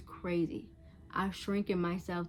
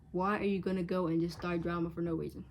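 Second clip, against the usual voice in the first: ordinary talk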